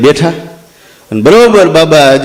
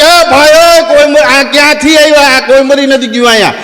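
startled protest; 7 semitones higher than usual. Retold or imitated. Owner startled by mourners.

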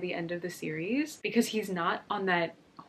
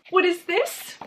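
high, loud, rising disbelief; pitched way up, stunned and questioning; shocked disbelief; 9 semitones higher, 5 dB louder, slower than usual. Real, not acted. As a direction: blurt it out in shock. Shocked at the turned page.

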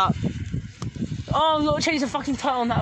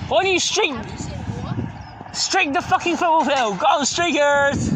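calling out; 8 semitones higher, slower than usual. Real, not acted. Egging friends on to streak.

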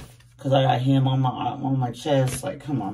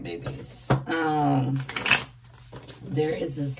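normal talking voice

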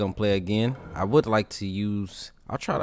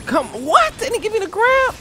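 surprised. Real, not acted. Surprised by opponent's move.

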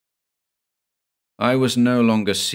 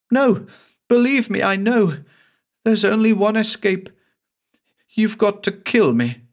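high and louder, frantic insistence; desperate Cassie; 13 semitones higher, 4 dB louder than usual. Acted. Cassie begs her lover to kill her, high desperate plea. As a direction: plead for death, shrill with despair.

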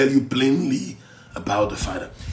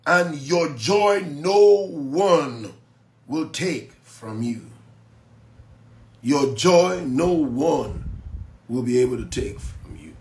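drawn-out, emphatic sermon delivery; slow preaching; slower than usual. Acted. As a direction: preach each word slowly.

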